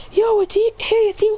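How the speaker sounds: high, loud, delighted hello; excited greeting. Acted. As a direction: burst out happy to see him. Shisui greets revived friend.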